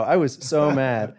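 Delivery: usual speaking voice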